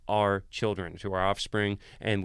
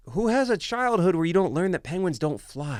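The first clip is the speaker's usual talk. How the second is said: incredulous; 6 semitones higher than usual. Retold or imitated. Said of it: "And I'm like".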